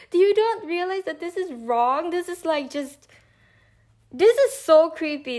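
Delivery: high, loud incredulous scolding; high outraged confrontation; outraged disbelief. Retold or imitated. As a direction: scold in shocked disbelief, laughing. Retold confronting the stalker.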